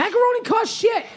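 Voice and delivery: high-pitched comic outburst; squawked protest; mock indignant shout. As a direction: yell it in playful outrage. Mock protest during goodbyes.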